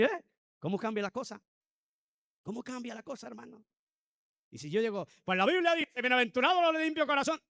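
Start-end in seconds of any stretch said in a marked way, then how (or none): 5.2-7.4 much higher and louder, scolding tone; harsh scolding. Shown as a demonstration. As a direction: throw the verse at them like an accusation. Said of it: "¿Estoy acercando a esa gente de Dios?". (regaño airado, mucho más agudo y fuerte)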